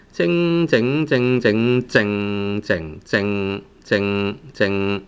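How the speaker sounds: "靜" repeated with a long, humming nasal ng at the end. Shown as a demonstration. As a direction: let each "靜" ring through the nose on its final sound. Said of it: "a lot of nasal sound at the end".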